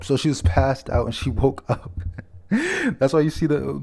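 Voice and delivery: usual speaking voice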